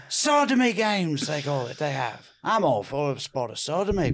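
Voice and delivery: breezy fancy English. Acted. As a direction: chat blithely in put-on posh English. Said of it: "Is this fancy English".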